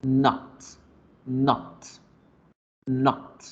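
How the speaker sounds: "not" with a short open English O; clipped open vowel in "not". Shown as a demonstration. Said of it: "Se dice not".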